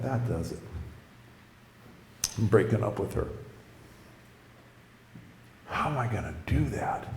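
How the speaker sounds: low, very soft inner voice; hushed, low thinking aloud; muttered resolve. Retold or imitated. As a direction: mutter it to yourself, defeated.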